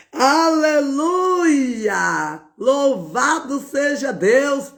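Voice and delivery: high, slow aleluia through laughter and tears; real overwhelmed praise, slow and high; laughing, crying praise. Real, not acted. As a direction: let the joy break through into tears. Overcome while praising God.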